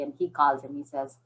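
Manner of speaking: ordinary talk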